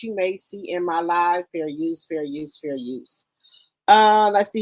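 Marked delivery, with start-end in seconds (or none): 1.5-2.6 softly. Acted. Repeating legal phrase, voice fading. 2.6-3.3 trailing off almost to nothing; very soft, fading out. Acted. Last repeat, fading out.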